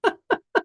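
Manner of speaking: short high giggle. Real, not acted. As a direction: giggle at the joke. Laughs at his own joke.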